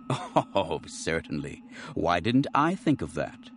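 usual speaking voice

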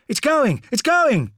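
high, loud urgent shout; shouted warning. A narrator's reading. Soldier at the back as the statue gives, shout. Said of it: "shouted someone at the back of the group".

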